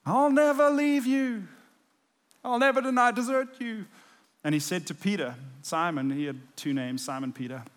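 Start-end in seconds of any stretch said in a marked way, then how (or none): 0.1-3.9 higher, louder mock vow; making fun of Peter's promise; hammed-up boastful vow. Mocking Peter's empty vow.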